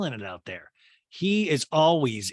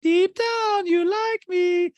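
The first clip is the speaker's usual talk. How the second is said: high, louder, slow mocking echo; mocking Garcelle; 7 dB louder, much slower than usual. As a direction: throw it back high and slow. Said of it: "Garcelle's like".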